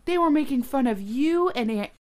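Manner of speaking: high, loud retold accusation; mock outrage. Girl reporting others made fun of family, outraged. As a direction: imitate an offended child tattling.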